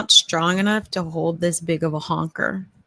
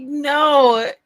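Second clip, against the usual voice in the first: mock scolding; 4 semitones higher, 8 dB louder, much slower than usual. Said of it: "It was like".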